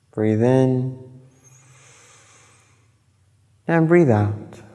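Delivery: unhurried phrases, leaving space between each instruction; guided meditation. Acted. Yoga teacher guiding listeners through breathing and body awareness, calm meditation voice.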